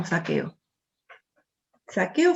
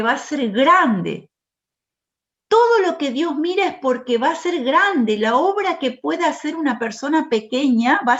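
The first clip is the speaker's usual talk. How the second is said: raised, high impassioned sermon voice; fervent preaching; 3 semitones higher, 5 dB louder than usual. Acted. Preacher exhorting the congregation, in a loud fervent preaching voice. Preacher rising to full force.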